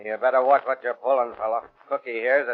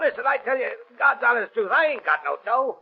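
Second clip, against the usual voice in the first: higher, strained begging; frightened pleading; 12 semitones higher than usual. Acted. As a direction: plead for your life in a higher voice. Blackie begs under torture.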